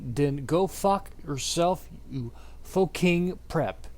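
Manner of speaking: ordinary delivery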